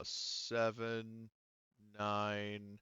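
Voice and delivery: normal talking voice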